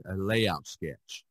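usual speaking voice

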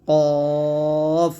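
কফ stretched very long, tongue root on the palate; slow, full, sustained কফ; thick articulation. Shown as a demonstration. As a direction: hold a heavy, back-of-tongue কফ. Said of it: "মোটা করে উচ্চারণ করতে হবে".

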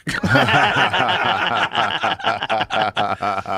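long, loud burst of laughter; loud belly laugh. Real, not acted. Storyteller cracks up at his punchline, long loud laugh. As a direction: crack up hard and keep going.

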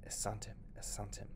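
hushed secret whisper. Acted. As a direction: say it to yourself so no one hears. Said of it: "he said the word to himself softly".

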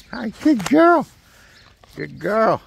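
high-pitched puppy-praising voice; pet-talk praise. Real, not acted.

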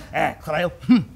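ordinary talk

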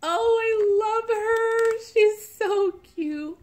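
high, drawn-out cooing gush; smitten, lingering high coo; adoring gush. Real, not acted. Gushing over the doll.

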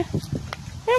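whispered call. Real, not acted. Owner whispering to coax her dog closer. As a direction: breathe it to the dog.

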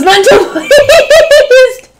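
slow, high, laughing through it. Real, not acted. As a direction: giggle through the words. Reader laughs at picture.

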